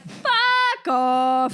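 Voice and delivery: light joking Canadian "fuck off"; a Canadian teasing a friend. Retold or imitated. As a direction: joke it out like a Canadian. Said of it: "We're like".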